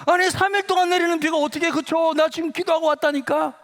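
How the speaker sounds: very high, breakneck-excited acted argument; exasperated insisting. Student arguing with doubting classmate, exasperated and excited. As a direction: argue back at a high, urgent pitch. Boy argues back, acted quarrel.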